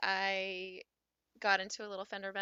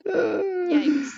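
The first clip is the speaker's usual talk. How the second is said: loud high yelp of shock; shocked yikes; 10 semitones higher, 12 dB louder than usual. Real, not acted. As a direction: cry out in sympathy. Reacting to car breaking down.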